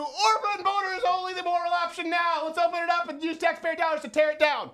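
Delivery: mock zealous activist. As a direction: parody an overeager convert preaching open borders. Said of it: "how fast that went from".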